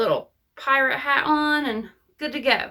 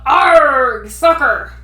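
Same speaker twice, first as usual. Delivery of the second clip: loud gruff shout; pirate yell; 8 dB louder, much slower than usual. A narrator's reading. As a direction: bellow it kicking the ball. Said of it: "kicked the ball and yelled".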